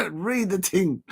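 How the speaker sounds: slow, laughing South London "Read the ting" with ting for thing; South London slang. Retold or imitated. Streamer urging viewers to read it, lightly South London. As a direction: drawl it out like a South London mate. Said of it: "as they say in South London".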